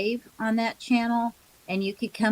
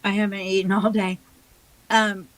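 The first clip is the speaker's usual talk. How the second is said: mouth full. Real, not acted. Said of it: "Sorry, I'm eating".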